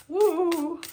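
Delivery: high, loud excited woo; giddy squeal of excitement; excited whoop. Real, not acted. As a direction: let out a delighted whoop. Reacting to a steamy card reading.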